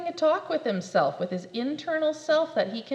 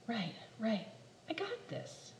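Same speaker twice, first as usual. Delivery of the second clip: quiet self-assurance; 10 dB quieter than usual. Acted. Caterpillar reassures self.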